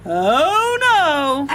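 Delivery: a dad caught off guard; father's dismay. Acted.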